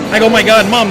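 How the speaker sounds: ordinary delivery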